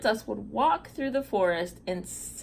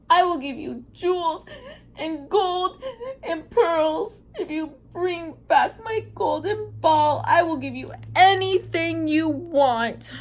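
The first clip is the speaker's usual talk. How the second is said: higher, louder, sniffling through fake tears; hammed-up crying plea; 7 semitones higher, 4 dB louder than usual. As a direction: plead like a spoiled princess in tears. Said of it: "sniffed the princess while she was crying".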